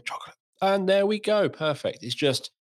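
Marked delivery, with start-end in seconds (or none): 0.1-0.4 quick whispered nickname. Retold or imitated. Father's trigger word for daughter, whisper. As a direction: drop to a soft whisper. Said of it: "whispering chocolate across this room".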